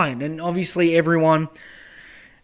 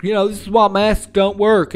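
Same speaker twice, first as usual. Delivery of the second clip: mock anti-masker; 3 semitones higher, 6 dB louder than usual. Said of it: "being like".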